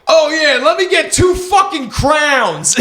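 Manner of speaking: hammed-up drunk customer asking for crowns; slow mock shout. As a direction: stretch the order out for laughs. Drunk ordering continues.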